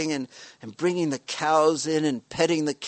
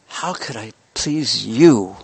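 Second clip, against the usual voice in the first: sincere devotee; 3 semitones lower, 5 dB louder, slower than usual. Retold or imitated. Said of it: "and says".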